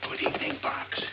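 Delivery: voiceless strained greeting; hoarse whisper. Acted. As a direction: whisper it with a lost voice.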